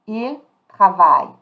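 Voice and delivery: "Il travaille" loud, ending on "vai"; silent ENT. Shown as a demonstration. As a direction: model "travaille" with no ENT sounded. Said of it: "I'm not pronouncing the E N T".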